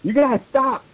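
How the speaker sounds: high-pitched pretend anger; mock angry shout. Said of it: "I shooted angrily".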